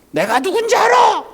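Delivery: high-pitched imitation of an irate customer; mock angry outburst. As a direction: act out an indignant restaurant customer. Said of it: "막 화를 내더래요". (화난 손님 흉내 내며 호통치듯)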